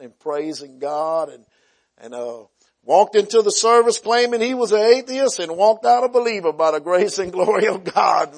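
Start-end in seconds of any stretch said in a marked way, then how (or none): 2.8-8.4 higher, louder rising proclamation; joyful preacher's cry; triumphant preaching. Real, not acted. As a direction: celebrate the victory from the pulpit.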